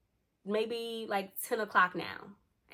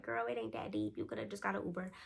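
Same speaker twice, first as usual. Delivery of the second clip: imagined dismissive listeners; 8 dB quieter than usual. Retold or imitated. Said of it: "I know y'all like".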